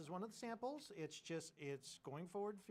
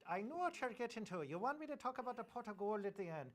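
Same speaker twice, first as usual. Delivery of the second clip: higher, playful Irish brogue; Irish accent; 5 semitones higher, 3 dB louder than usual. Acted. Co-host takes up the pot of gold, higher Irish accent. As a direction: slip into a lilting, high-pitched Irish accent. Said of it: "go in the Irish accent again".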